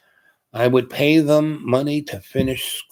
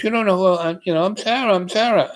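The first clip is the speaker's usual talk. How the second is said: pitched up, lightly voiced eager woman; a hint of an excited woman insisting on her name; retold woman's eager voice; 9 semitones higher than usual. Old friend introducing herself to him on the street, lightly voiced, pitched up and eager. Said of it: "And she goes".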